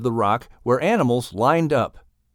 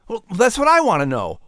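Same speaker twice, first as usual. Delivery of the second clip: ordinary talk, nothing marked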